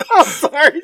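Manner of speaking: high, loud burst of laughter; laughing. Real, not acted. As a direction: crack up and apologize through it. Bursts out laughing mid-point.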